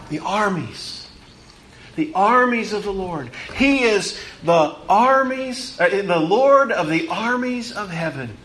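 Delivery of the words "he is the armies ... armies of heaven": normal talking voice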